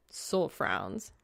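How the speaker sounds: plain reading voice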